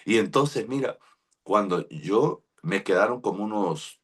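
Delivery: usual speaking voice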